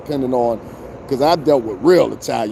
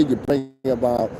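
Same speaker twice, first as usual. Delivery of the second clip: ordinary delivery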